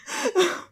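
high laugh; high burst of real laughter. Real, not acted. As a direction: laugh out at your own joke. Laughing at own joke.

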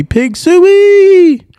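high, loud hog-calling holler. Real, not acted. Arkansas fan calling the hogs, loud high holler.

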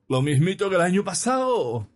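put-on high, jeering drunken singsong; mocking shout. A narrator's reading. Stubborn drunk heckling the sermon, loud joking shout. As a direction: bawl it out as a joke. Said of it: "a voz en grito y en tono de chunga".

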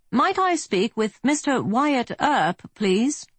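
courteous British request; well-to-do British. A narrator's reading. Briton asks the marshal through the door in a posh British accent. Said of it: "This accent was British, quite well-to-do".

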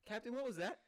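high, loud worried question; alarmed crewman. Acted. Crewman asking captain after a bang, put-on alarm. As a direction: ask the captain in mock alarm.

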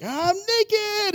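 put-on very high squealed child voice. Child runs into the small group squealing high about being naked. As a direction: squeal it like a kid running out. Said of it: "be like".

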